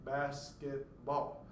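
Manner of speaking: slow, "basketball" split syllable by syllable; measured syllable count, each beat of "basketball" separate. Shown as a demonstration. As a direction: spell out "Where is my basketball" beat by beat. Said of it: "How many syllables do we have".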